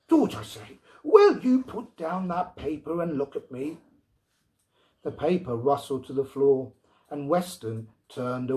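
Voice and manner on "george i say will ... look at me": sharp, insistent call; impatient wife. A narrator's reading. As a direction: demand his attention now. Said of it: "finally broke the silence".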